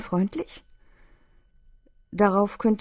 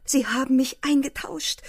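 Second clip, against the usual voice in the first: mild playful cry; 7 semitones higher than usual. A narrator's reading. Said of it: "rief sie von Zeit zu Zeit".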